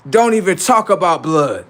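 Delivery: normal talking voice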